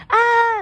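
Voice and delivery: short burst of high, loud screaming; demonstrated scream. Acted. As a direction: cut loose with a shrieked "ah".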